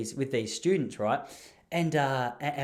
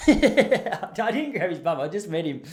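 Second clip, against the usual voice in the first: laughing through the words, higher and louder; 5 semitones higher, 6 dB louder, slower than usual. Real, not acted. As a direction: giggle it out as a joke. Laughing denial of bum grab.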